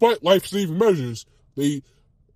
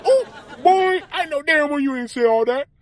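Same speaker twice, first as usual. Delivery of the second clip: put-on high, loud sassy girl voice; 10 semitones higher, 4 dB louder than usual. Girl catching you dissing Scooby, high sassy. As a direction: call him out in a shrill high voice. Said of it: "she goes like".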